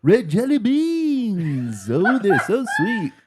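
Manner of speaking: loud folksy singer's pitch; [name] impression. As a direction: belt it like a folk troubadour. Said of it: "my Dylan impression".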